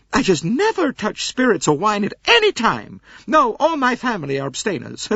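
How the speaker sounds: very high, coyly protesting teetotaler; coy American lady. A narrator's reading. Mrs Hubbard is offered cognac, coyly high-pitched. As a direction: pretend to refuse, then accept.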